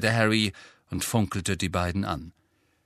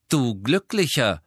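higher, louder, cool comeback; 5 semitones higher, 5 dB louder than usual. A narrator's reading. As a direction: hand it back coolly. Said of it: "erwiderte Ginny kühl". (kühl erwidert)

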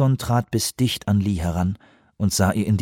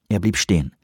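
usual speaking voice

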